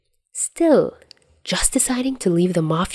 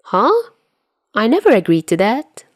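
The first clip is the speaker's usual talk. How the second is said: high startled huh, then a louder protest; 5 semitones higher, 3 dB louder than usual. Acted. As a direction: jump up in pitch, caught off guard.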